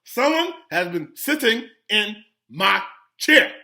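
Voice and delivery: loud, slow bear voice, each word punched; great big Papa Bear voice. A narrator's reading. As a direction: boom it out as an indignant big bear. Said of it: "in his great big voice".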